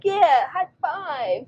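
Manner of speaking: slow, high, sing-song yes; mother playing along with her son; slow high praise; cheerful mom. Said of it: "I'm like".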